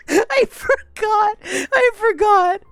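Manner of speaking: helpless high laughter breaking through every word; laugh-crying so hard the words barely come out; real laughing fit, voice cracking high and loud. Real, not acted. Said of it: "Oh, I'm crying".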